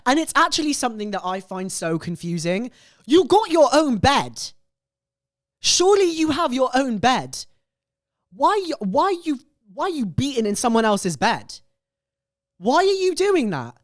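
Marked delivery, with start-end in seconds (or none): 3.1-7.4 high, loud disbelief; can't believe what she's hearing; incredulous. Real, not acted. Incredulous reaction to confession. 8.3-13.7 can't believe what she's hearing; incredulous. Real, not acted. Incredulous questioning.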